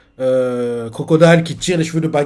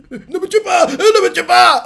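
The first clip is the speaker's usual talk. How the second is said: much higher shrieking plea, laughing through it; hammed-up terrified clown; panicked begging. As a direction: squeal for your life. Baggy begs for his life.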